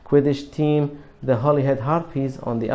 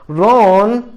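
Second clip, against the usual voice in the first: loud, higher call of his name; sharp warning call; 6 semitones higher, 9 dB louder than usual. A narrator's reading. Hermione trying to stop Ron's row with Harry, loud sharp call. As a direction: cut him off before it gets worse.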